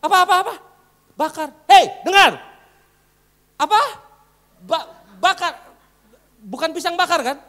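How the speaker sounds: loud high mock rebuke; hammed-up scolding Jesus. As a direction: play Jesus angrily questioning disciples. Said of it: "lalu Yesus berpaling dan gini".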